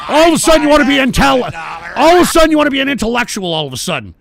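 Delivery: fast, loud, exasperated outburst; angry rant. Real, not acted. Host berating a would-be intellectual troll, fast angry shouting. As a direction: burst out yelling at racing speed.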